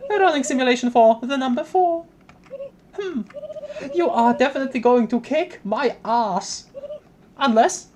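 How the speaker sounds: high-pitched simulation readout turning to alarm; computer queen. Acted.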